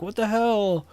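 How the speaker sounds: shocked outburst. Retold or imitated. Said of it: "they were like".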